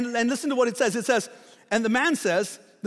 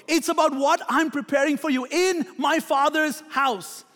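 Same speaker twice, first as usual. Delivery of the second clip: rising preaching; 4 semitones higher than usual. Acted. Builds to raised preaching.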